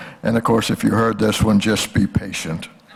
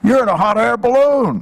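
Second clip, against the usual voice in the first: the man's reply; 11 semitones higher, 4 dB louder than usual. Retold or imitated. Man in field answers balloonist.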